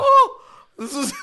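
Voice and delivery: very high, loud, laughing; laughing squeal. Retold or imitated. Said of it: "I was like".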